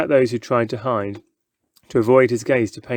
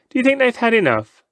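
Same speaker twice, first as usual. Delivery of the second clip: high, quick showman's question; teasing showman; 11 semitones higher, 3 dB louder, faster than usual. Acted. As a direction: tease the crowd like a ringmaster.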